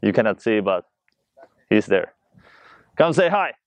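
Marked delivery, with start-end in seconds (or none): 3.0-3.5 higher-pitched, coaxing call; calling over. Real, not acted. Inviting friend behind the camera to say hi, calling over.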